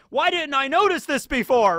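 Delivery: loud, high acted outburst; mock dismay. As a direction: cry out in sudden self-reproach. Acts out Luffy's sudden realization.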